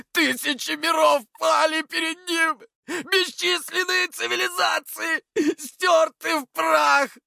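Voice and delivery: high, slow, desperate wailing shout; hysterical screaming. A narrator's reading. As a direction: rant in shrieking disbelief. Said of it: "но он продолжал орать". (истерический надрывный крик)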